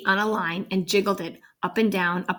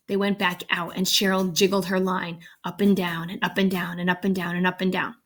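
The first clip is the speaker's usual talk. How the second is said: plain reading voice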